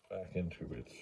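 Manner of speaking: ordinary talk, nothing marked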